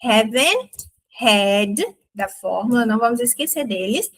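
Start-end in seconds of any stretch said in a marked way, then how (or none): 0.0-1.8 haven't had drawn out very slowly; stretched teaching demo. Shown as a demonstration. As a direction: spell out "Haven't had" word by word. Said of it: "vai ser a nossa fórmula com haven't".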